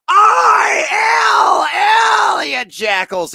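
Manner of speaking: high, loud triumphant yell; triumphant shout. Real, not acted. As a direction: shout it like a fan cheering his player. Cheering his player's card.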